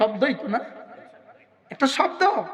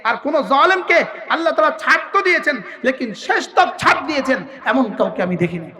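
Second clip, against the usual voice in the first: normal talking voice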